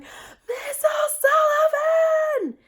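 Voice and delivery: high, drawn-out happy shout; excited kids calling. Retold or imitated. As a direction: call out her name across the room.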